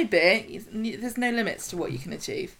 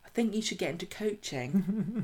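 quiet mocking; 8 dB quieter than usual. Voicing everyone dismissing James.

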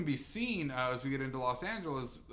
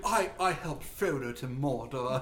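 slow, hammed-up hobbit actor impression; mock-grand boast, dragged out slowly; mock Billy Boyd; 3 dB louder, slower than usual. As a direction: draw it out in a put-on actor's voice. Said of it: "He's like".

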